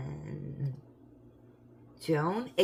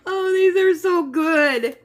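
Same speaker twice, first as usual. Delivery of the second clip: delighted; 13 semitones higher, 13 dB louder than usual. Real, not acted. Savoring chocolate, delighted.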